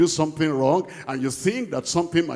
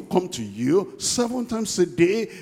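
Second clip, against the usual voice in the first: ordinary delivery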